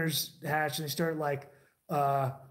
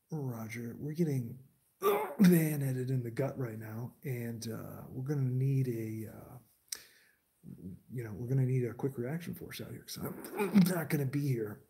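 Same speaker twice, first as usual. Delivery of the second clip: softer, calm and matter-of-fact radio call; 5 semitones lower, 6 dB quieter than usual. Shown as a demonstration. Showing how soldiers really talk on the radio, calm and soft. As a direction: report it coolly like a real radio operator. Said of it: "The way that you talk on the radio is you're like".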